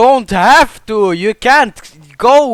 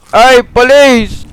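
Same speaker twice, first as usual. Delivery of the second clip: calling out; 6 semitones higher, 5 dB louder, slower than usual. Real, not acted. Calling out to a police officer.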